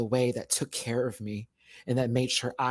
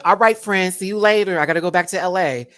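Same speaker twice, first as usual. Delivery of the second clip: imitating [name]; 5 semitones higher, 9 dB louder than usual. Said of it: "she was like".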